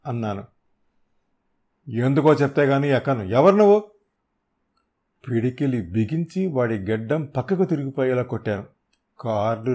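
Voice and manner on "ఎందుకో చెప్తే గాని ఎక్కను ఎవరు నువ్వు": defiant shout. Acted. Thug refusing the stranger's car, defiant shout. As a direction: shout him down, rising to a yell.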